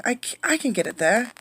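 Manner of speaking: a hint of an eager small girl; lightly voiced little girl. Irene's eager child voice.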